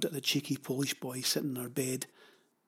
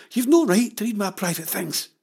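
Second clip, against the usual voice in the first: raised, higher indignant outburst; angry; 6 semitones higher, 7 dB louder than usual. Acted. Jenny learns Pavel read her letter, flushing with anger. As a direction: protest hotly, loud and high. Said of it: "Jenny flushed with anger".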